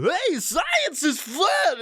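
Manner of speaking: very high, slow goofy scientist voice; squealing dorky presenter. Retold or imitated. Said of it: "dorky scientist".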